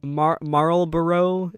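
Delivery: "Marlboro" dragged out slowly with a false start; slow, effortful "Marlboro"; struggling pronunciation. Real, not acted. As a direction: labour through "Marlboro", sounding every syllable. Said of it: "that's the only way I could say it".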